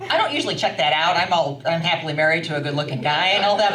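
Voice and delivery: ordinary delivery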